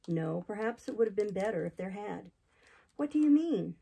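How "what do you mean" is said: alarmed. Acted. Jane alarmed by the hint.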